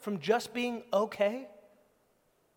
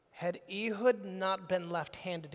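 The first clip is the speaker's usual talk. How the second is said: normal talking voice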